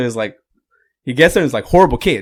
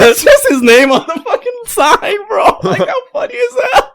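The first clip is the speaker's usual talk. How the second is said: words squeezed out through real laughter, much higher; cracking up at the punchline; laughing hard; 4 dB louder than usual. Real, not acted. Laughing at his own punchline.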